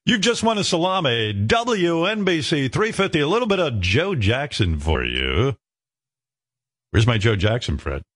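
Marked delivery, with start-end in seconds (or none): 0.0-5.6 radio DJ voice. Pretend. Pretend DJ read.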